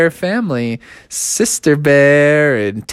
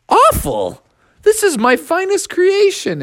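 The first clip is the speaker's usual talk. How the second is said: offended T-Rex. Acted.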